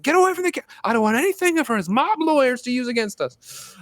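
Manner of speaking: high, urgent put-on police commander voice; Gordon. Police boss walking into the jail, high put-on commanding voice. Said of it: "Gordon walks in and is like".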